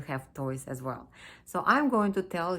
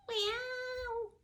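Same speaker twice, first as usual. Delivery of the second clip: high, softer drawn-out meow; a puppy's tentative try at a cat's meow; thin, high imitation meow; puppy copying meow; 4 dB quieter than usual. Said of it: "So he said".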